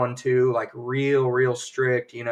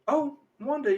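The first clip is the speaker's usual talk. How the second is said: much higher, airy mock housewife greeting; sudden chatty lightness; 13 semitones higher than usual. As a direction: flit up high as if nothing happened. Voices Dottie suddenly all sweet.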